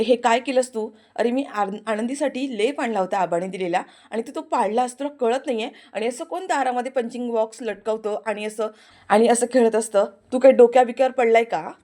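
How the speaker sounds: loud, building scolding rant; rising angry rant ending near a shout. Acted. Aarti's loud quarrel.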